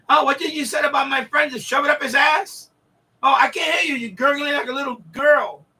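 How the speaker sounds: mock taunting shout. Pesci taunting the guy he just beat up, hammed-up gloating yell. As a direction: gloat over him as you stomp him.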